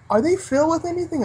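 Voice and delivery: high puzzled question; mock innocent customer question; clueless customer. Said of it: "the guy would say".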